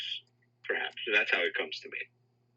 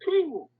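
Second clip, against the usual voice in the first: whew; 3 dB louder than usual. Real, not acted. Emotional release after story.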